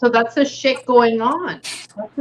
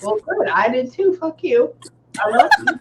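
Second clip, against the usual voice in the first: much higher, mock-sweet sassy retort; sassy comeback; 8 semitones higher than usual. Medium teases the ex-husband's spirit, high and sassy. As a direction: fire back cheekily, then gush.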